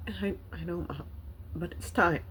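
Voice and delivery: soft, halting retold reply to mom; choked up. Daughter telling her mom it's time, choked up. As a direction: struggle gently to get the words out. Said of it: "I'm just so choked up".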